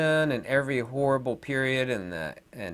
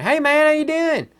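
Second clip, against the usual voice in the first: much higher, louder hammed-up Southern greeting; Southern accent; 8 dB louder than usual. Shown as a demonstration. Vlogger showing he can talk like a Southerner, hammed-up accent. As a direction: greet like a good old Southern boy. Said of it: "I could talk like a Southerner".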